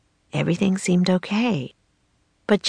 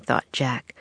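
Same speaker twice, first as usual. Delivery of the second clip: ordinary delivery, nothing marked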